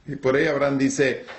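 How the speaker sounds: ordinary talk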